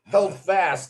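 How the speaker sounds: usual speaking voice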